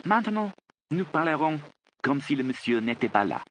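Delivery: high voice speaking French; young woman Frid. A narrator's reading. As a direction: pitch it up, conspiring in French.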